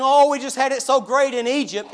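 mock complaining Israelites. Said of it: "Here they are saying".

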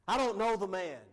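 emphatic quoted protest, pushed out loud; vehement denial. Retold or imitated. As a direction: deny it forcefully, as the cornered disciple. Acted denial of Jesus by Peter.

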